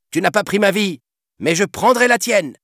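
put-on high, loud boyish defiance; defiant hero. A narrator's reading. Peter threatening the captain before the duel, defiant. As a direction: throw it back at him.

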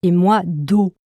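usual speaking voice

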